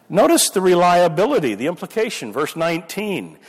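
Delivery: normal talking voice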